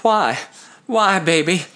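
high plaintive begging lover; pleading. Acted. Lover pleads as she leaves.

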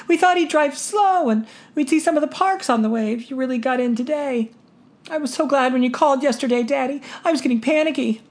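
put-on high girlish voice, pleading; pleading daughter. A narrator's reading. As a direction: coax her father.

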